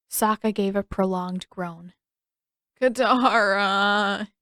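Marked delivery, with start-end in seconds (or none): 2.8-4.3 slow, drawn-out whine of the name; whiny plea; whined. Acted. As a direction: stretch it out like a sulky kid begging. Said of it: "he whined".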